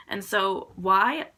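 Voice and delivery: ordinary talk, nothing marked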